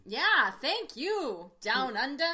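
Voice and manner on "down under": put-on louder playful Australian accent; mock Australian accent. Host greeting Australian listeners, playful Australian accent. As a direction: put on an Aussie twang. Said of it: "all the Australian I know".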